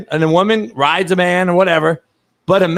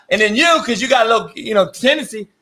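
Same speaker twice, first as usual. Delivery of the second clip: usual speaking voice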